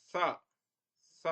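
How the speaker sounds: स as a dental s, tongue at the teeth. Shown as a demonstration. Teacher showing students how स is pronounced, dental s. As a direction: say each स separately with the tongue at the teeth. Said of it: "इसका उच्चारण इस प्रकार होगा".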